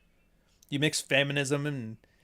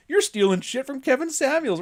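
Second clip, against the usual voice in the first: mock accusing; 12 semitones higher, 4 dB louder than usual. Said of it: "everybody's like".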